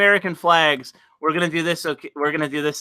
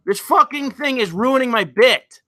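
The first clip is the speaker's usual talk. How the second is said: high-pitched frustrated complaint; exasperated; 7 semitones higher than usual. Real, not acted. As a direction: complain in exasperation as the joke falls apart. Missing emoji spoils his joke.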